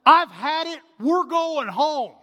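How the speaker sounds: playing the wife who has snapped; a hint of an exasperated mother; fed-up wife. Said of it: "she says".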